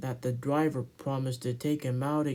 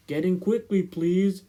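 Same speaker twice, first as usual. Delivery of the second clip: 1 calling out; 5 semitones higher, 6 dB louder than usual. Acted. Edward calls to passengers.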